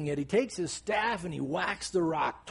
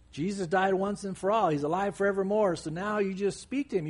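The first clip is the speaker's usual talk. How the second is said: ordinary talk, nothing marked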